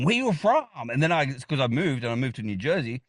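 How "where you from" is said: much higher mock-curious question, rising at the end; high, swooping imitation of intrigued strangers; imitated curiosity. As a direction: mimic the same old nosy question. Repeats people's question every time.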